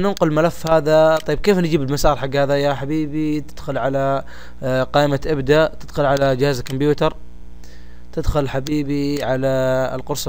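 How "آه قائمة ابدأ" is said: ordinary talk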